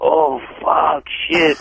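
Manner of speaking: ordinary delivery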